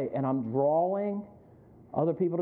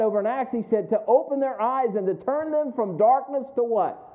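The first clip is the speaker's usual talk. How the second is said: higher-pitched, driving sermon delivery; impassioned preaching; 5 semitones higher, 3 dB louder than usual. Real, not acted. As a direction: preach it with raised pitch and insistent emphasis. Preaching builds to full force.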